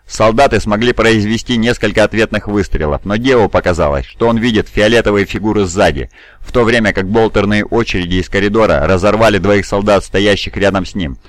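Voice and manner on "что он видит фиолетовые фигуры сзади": plain reading voice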